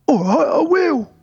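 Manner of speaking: very high, slow meek voice; hammed-up simple, obedient beggar. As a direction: agree in a drawn-out high pitch. Beggar meekly agrees to go.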